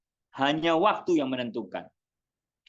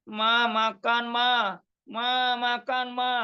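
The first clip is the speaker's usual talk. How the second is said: put-on a higher, slow, whiny plea from a hungry child; child pleading for food; 6 semitones higher, slower than usual. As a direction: drag out each "Ma" like a hungry kid tugging a sleeve.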